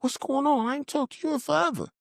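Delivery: very high-pitched phone hello; retold surprised greeting. Answering someone he hadn't talked to in years, high surprised greeting. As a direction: pitch it way up, catching up. His surprised reply to caller.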